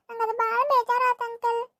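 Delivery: ordinary talk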